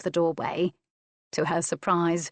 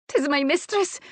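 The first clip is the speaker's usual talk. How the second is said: high, breathless maid's cry; gasped alarm; 9 semitones higher, 3 dB louder than usual. A narrator's reading. Maid brings news to the master, gasping in alarm. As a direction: gasp out the bad news. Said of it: "Maggie gasped".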